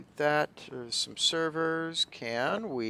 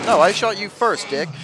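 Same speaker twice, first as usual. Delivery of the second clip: loud, high indignant yell; raging gamer; 7 semitones higher, 11 dB louder than usual. Real, not acted. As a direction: shout it at the enemy. Arguing with enemy in game.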